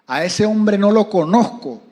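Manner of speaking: slow, loud retold shout; shouted denial. Peter swearing to the crowd, slow loud shout. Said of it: "o sea que lo gritó".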